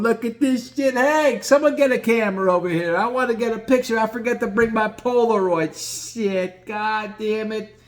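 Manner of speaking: high, gleeful, eager caller; gleeful Gino. Retold or imitated. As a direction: delight in the gross scene. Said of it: "Gino's right there going".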